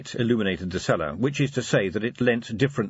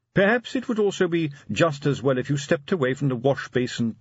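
higher, deferential suggestion; cautious butler; 6 semitones higher than usual. A narrator's reading. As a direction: suggest it delicately to the master. Butler cautions his master.

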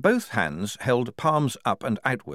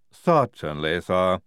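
lightly voiced lisping Igor; calm helpful Igor, a touch of lisp; Igorina; 2 semitones lower, 3 dB louder, slower than usual. A narrator's reading. Igorina answering the major, mild lisping voice.

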